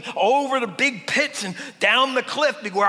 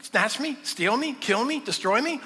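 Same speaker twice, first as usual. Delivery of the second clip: ordinary talk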